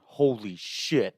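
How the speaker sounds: slow, drawn-out amazed gasp of words; awed holy shit. Retold or imitated. Tex finding a jar of weed, slow and awed. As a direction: stretch it out in disbelief.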